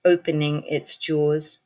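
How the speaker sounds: usual speaking voice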